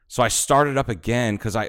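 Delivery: usual speaking voice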